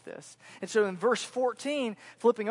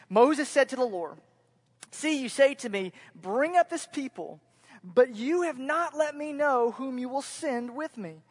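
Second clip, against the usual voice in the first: raised, emphatic Bible reading; higher, projected pulpit reading; scripture reading; 5 semitones higher, 6 dB louder than usual. Acted. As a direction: read the verses out with urgent, measured force. Reads scripture aloud.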